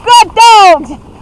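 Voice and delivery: high, loud dog praise; squeaky praising a dog. Real, not acted.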